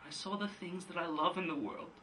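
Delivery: very soft, halting, long pauses between phrases; hushed recollection. Acted. Son telling his father what he saw, hushed. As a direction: drop right down and let each memory land. Monologue, Biff recalls seeing sky.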